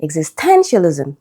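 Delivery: "Existentialism" stressed on TEN; third-syllable stress. Shown as a demonstration. Teacher showing an exception, third-syllable stress. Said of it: "third syllable".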